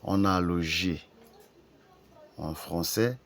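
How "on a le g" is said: ordinary talk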